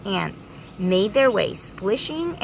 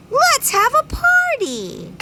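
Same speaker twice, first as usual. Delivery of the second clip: very high, excited bee voice; party bees; 4 dB louder than usual. Acted. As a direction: call out with glee. Bees celebrating.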